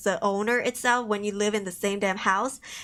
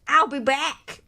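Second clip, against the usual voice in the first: louder, far-off menacing promise; movie line; 3 semitones higher, 6 dB louder, slower than usual. Acted. Killer signing off to police, menacing movie-villain promise. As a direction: announce the return like a movie villain. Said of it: "movie line impression".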